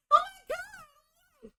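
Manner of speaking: high-pitched hammed-up wail; mock crying. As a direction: imitate a woman breaking into sobs. Acts out woman hearing her bond.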